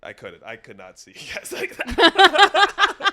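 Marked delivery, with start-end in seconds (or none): 1.5-3.1 laughing, drawn out. Real, not acted. Laughing protest.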